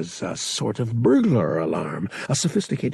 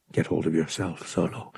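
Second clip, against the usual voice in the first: stern self-order; 5 semitones lower, 6 dB quieter than usual. A narrator's reading. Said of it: "he ordered himself sternly".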